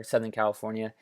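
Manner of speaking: normal talking voice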